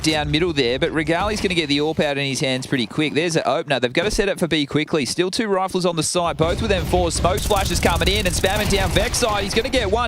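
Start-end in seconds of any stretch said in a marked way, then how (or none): 3.1-10.1 rising, excited esports call; play-by-play casting. Acted. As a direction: call the round building to a peak.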